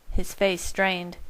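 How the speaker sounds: ordinary delivery, nothing marked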